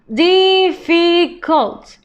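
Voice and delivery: difficult stretched slowly, each syllable clear; slow modelling voice. Shown as a demonstration. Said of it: "teacher models word then slows".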